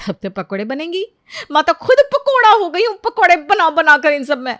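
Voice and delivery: loud, high whiny venting; venting to a friend. Acted. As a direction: pour out her woes to Neha. Priya complains to friend on phone. (सहेली नेहा से phone पर ऊँची आवाज़ में दुखड़ा रोती)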